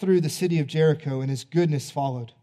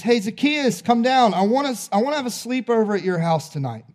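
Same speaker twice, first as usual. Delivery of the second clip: calling up a tree; 6 semitones higher, 3 dB louder than usual. Acted. Jesus calls up into the tree.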